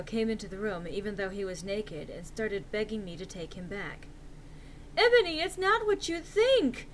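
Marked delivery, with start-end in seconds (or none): 4.9-6.9 high overacted desperate plea; hammy stage actor. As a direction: melodramatic begging. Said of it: "Draco screamed sadly".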